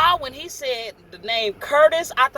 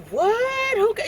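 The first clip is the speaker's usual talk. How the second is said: shocked; 10 semitones higher, slower than usual. Retold or imitated. Shocked at hearing of a death.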